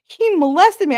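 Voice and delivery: high and loud put-on wail; mock dramatic reveal. Mocking the coworker's reveal in a high loud wail. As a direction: overplay the big confession.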